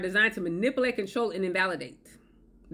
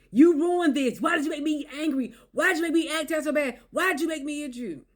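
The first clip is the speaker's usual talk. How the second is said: mock accusing; 6 semitones higher than usual. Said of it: "They'll say".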